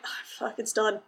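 breathy whispered dismay, fading out; whispered curse. Retold or imitated. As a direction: hiss it under the breath like a gamer who just messed up. Gamer panics after sudden stop.

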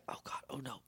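voiceless hushed whisper; panicked whisper. Retold or imitated. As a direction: whisper so she can't hear. Rangers hiding from Penny.

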